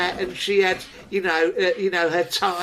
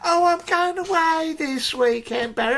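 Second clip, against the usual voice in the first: put-on breezy girlfriend; 10 semitones higher than usual. Girlfriend telling Barry she is going away, breezy. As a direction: imitate her airy casual tone.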